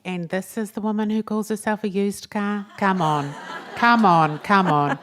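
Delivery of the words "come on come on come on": loud, insistent cheer-on; much louder, playful remonstration; mock protest. Real, not acted. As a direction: punch each word out loudly. Mock protest at self-deprecation.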